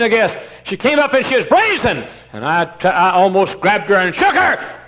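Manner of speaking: loud, high, driving sermon story; preaching at full force. Acted. Preacher telling of the brazen girl, loud and fierce. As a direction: drive the story hard from the pulpit.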